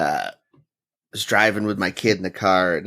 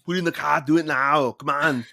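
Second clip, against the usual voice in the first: higher Austrian commands, louder on the last; Arnold shouting; 7 semitones higher, 3 dB louder than usual. Retold or imitated. Dad says what he should have said to his son, a higher shouted Austrian accent. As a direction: yell like Arnold rushing someone. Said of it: "You do it very well".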